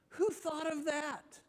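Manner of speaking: high, drawn-out incredulous question; appalled disbelief, pitched high and slow. Acted. Mock outrage at coining a curse.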